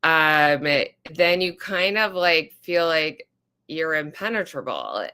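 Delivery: ordinary delivery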